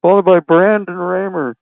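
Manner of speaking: talking into a pillow. Retold or imitated. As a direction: call the race as if speaking into a pillow. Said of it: "It sounds like he's talking into the pillow".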